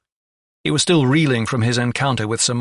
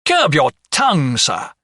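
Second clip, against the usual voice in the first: loud, high start dropping on the last word; indignant roar; 4 semitones higher, 6 dB louder than usual. A narrator's reading. Steward takes offence at the doctor's remark, roaring indignantly. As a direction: roar a sharp rebuke. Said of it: "he roared indignantly".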